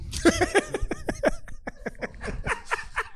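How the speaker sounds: high breathy burst of laughter, real. Real, not acted. Cracking up at the Abike Dabiri joke, long high laugh.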